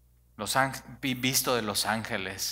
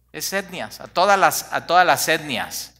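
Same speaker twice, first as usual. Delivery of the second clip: excited emphasis; 4 semitones higher, 6 dB louder than usual. Real, not acted. Emphatic explanation of gentiles.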